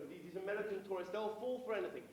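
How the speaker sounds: ordinary talk, nothing marked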